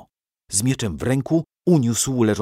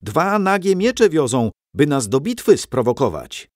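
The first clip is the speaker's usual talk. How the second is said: high, raised soldier's voice; excited report; 5 semitones higher, 4 dB louder than usual. A narrator's reading. Soldier reporting the enemy's provocation, high and raised. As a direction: call out the news to the ranks.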